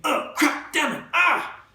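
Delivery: high yelps while tumbling; screaming. A narrator's reading. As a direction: cry out rolling down the dune.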